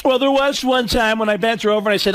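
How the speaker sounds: normal talking voice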